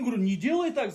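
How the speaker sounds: usual speaking voice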